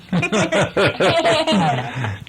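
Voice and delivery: real high giggle; laughing. Real, not acted. Reader and child joking over Craptain, laughing. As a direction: giggle helplessly at a silly word.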